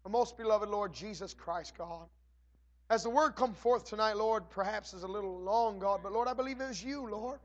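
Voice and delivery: measured prayer voice; formal prayer. Acted. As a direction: address the Lord reverently.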